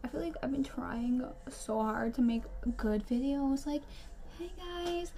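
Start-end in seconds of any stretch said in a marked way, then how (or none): 4.4-5.1 high, soft, sweet-talking intro voice; put-on vlogger greeting. Mocking her try-hard good-video persona, put-on greeting voice. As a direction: imitate an unnatural YouTube hello. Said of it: "I'm not like that in person".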